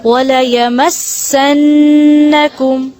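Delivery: slow وَلَيَمَسَّنَّكُمْ with the نّ held in the nose; careful tajweed reading of وَلَيَمَسَّنَّكُمْ; nasal ghunna on doubled n. Shown as a demonstration. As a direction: draw out the نّ of وَلَيَمَسَّنَّكُمْ. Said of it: "اس کو کیسے پڑھیں گے؟".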